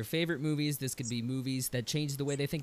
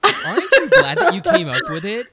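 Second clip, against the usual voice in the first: laughing; 14 semitones higher, 12 dB louder than usual. Real, not acted. Teasing laugh at guest.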